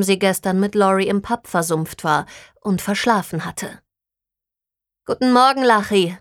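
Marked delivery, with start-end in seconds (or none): 5.0-6.2 bright greeting called out, raised in pitch at first; calling out. A narrator's reading. Greeting Lachi through the half-open office door, calling out. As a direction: call a morning greeting to a colleague. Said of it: "rief sie durch die halboffene Tür".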